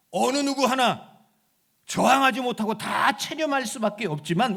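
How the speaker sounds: loud, soaring sermon delivery; full-force preaching. Acted. Preacher proclaiming victory over death, loud and soaring.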